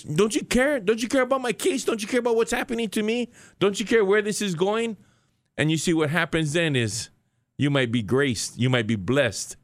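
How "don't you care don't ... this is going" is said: high, insistent acted plea; anxious pleading. Prisoner in a storm crying out to Jesus, high pleading. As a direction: plead in a much higher voice, question after question. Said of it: "you're like the disciples".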